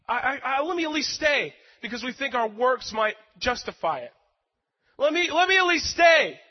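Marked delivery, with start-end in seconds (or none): 5.0-6.4 high, loud pleading; a servant begging the Lord, much higher and louder; desperate plea. Retold or imitated. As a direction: beg to be allowed to help. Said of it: "pleading with God".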